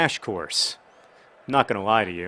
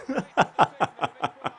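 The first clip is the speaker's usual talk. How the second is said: giddy high laugh of relief; delighted laugh; 9 semitones higher than usual. Real, not acted. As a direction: laugh out the joy of the goal. After scoring the goal.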